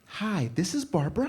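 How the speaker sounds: bright phone hello; mom on the phone. Retold or imitated.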